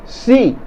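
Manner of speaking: si jumps high and bright; emphatic si. Shown as a demonstration. Teacher stressing si to a learner. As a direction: land the si up high to contradict. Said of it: "si is used to say yes".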